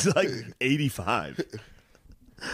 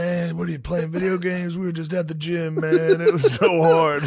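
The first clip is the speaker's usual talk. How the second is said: higher, building louder bragging guy; mock gym bro; 7 semitones higher, 3 dB louder than usual. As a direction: brag about the gym, louder at the end.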